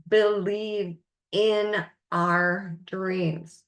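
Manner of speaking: believe said slow and drawn out, leaning on it; stress on believe. Shown as a demonstration. Coach urging listeners to trust their dreams, slow stress on "believe". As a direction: lean hard on "believe", slowly. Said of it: "I emphasize the word believe".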